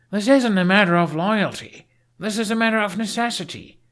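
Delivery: heated rebuttal. A narrator's reading. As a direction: push back hard. Hiccup argues back in the fight.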